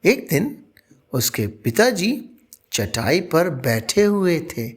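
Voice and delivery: plain reading voice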